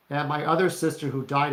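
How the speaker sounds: ordinary talk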